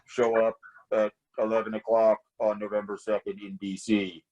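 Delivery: muffled anonymous caller voice. Retold or imitated. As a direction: voice muffled as if disguised on phone. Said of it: "and a muffled voice".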